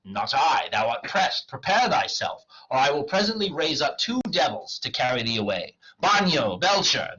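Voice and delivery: declaimed threat building to loud, high calls; commanding summons. Acted. Wagner summons devils on the clown, calling out. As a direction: call up devils with theatrical force.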